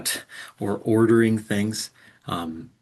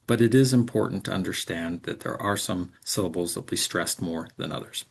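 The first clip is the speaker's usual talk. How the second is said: ordinary talk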